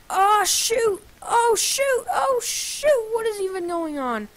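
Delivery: high-pitched, rising oh-shoot panic; high flustered yelps; panicked alarm. Real, not acted. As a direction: squeal in alarm as play goes wrong. Alarmed in the middle of play.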